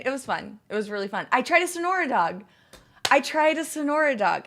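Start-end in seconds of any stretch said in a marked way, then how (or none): 1.3-2.4 excited, high and loud. Real, not acted. Excited streamer raving to chat about a Sonora dog. 3.1-4.4 excited. Real, not acted. Streamer repeating to chat, still excited.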